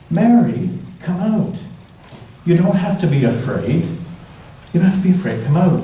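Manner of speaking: a high, kindly heavenly messenger coaxing; angel voice. Acted.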